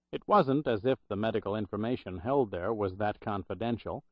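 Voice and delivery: usual speaking voice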